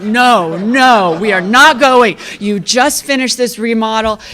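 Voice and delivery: loud mock protest, each word driven home; husband's emphatic refusal. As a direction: imitate a husband firmly putting his foot down. Retold husband refusing to move.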